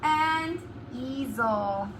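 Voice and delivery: drawn-out board reading; slow spelled-out reading. Shown as a demonstration. Teacher reading the board aloud to children, slowly. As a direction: say it slowly, word by word. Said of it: "write that one on the board".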